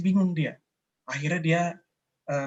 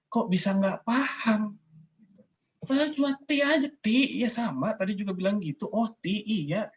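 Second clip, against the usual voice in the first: higher, puzzled, disbelieving; exasperated inner voice; 7 semitones higher than usual. Retold or imitated. Thinking to herself about the friend, high and baffled. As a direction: complain to yourself in high disbelief. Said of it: "Dalam hati saya bilang".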